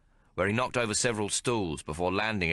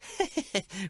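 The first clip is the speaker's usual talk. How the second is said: a high bawdy laugh; Maggie's laugh. Acted. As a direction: laugh it off cheekily. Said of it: "Maggie laughed".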